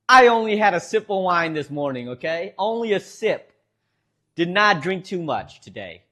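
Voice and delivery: normal talking voice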